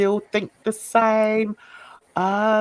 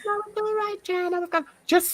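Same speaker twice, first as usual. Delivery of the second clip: imitating a friend; 13 semitones higher, 5 dB quieter, much faster than usual. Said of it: "you go on and you say".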